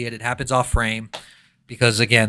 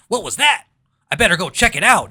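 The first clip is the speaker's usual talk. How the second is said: higher, fast stock video-game line; mock NPC guard; 6 semitones higher, 4 dB louder, faster than usual. Game guard hearing a noise, stock NPC voice. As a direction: rattle it off like a scripted NPC. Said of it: "Someone goes".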